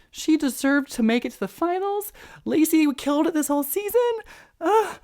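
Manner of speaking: squeaky high-pitched whining; strained high exasperated whine; weird high voice. Real, not acted. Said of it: "I know I just made a really weird voice".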